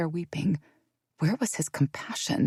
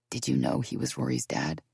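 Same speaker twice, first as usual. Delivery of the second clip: low quiet voice; 13 semitones lower, 6 dB quieter than usual. Acted. Sister's quiet question.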